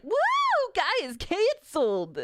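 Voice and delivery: high mock cheer, drawn out at the end; mock celebrating. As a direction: imitate a gloating crowd with a woo. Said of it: "celebrating and dancing".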